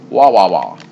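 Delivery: loud mocking wah wah. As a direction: blare a taunting wah wah. Mocking noise.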